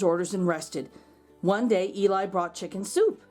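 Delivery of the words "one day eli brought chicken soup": plain reading voice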